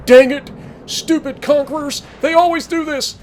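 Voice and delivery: high-pitched sore-loser whine; mock crying. Gamer mimics the opponent cursing conquerors, mock crying. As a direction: imitate a frustrated player wailing in defeat. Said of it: "this Dalian's probably crying".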